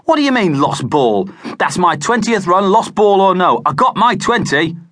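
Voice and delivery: put-on high, loud indignant boy; furious he's been cheated; angry protest. A narrator's reading. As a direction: batsman protesting angrily. Tony furious over lost run.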